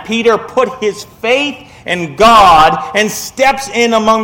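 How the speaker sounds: impassioned preaching. Real, not acted. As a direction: preach it with mounting conviction. Preaches at raised force.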